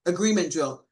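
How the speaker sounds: usual speaking voice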